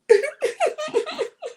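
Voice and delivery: very high-pitched fake sobbing; mock crying. Imitating Linda bursting out crying, mock sobs. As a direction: wail in an exaggerated imitation of crying. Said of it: "She's just crying".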